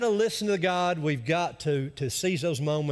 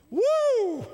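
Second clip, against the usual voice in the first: high, loud ecstatic whoop; joyful whoop; 5 dB louder than usual. Real, not acted. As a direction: let out a joyful church whoop. Preacher's joyful exclamation.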